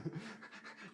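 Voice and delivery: high wheezy gasping laugh; wheezing laugh. Real, not acted. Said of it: "You sound like a dying horse down there".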